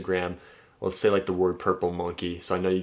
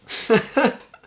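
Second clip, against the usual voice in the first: sudden high burst of laughter; real delighted laugh; 7 dB louder than usual. Real, not acted. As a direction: crack up, high and loud. Laughs at smooth reply.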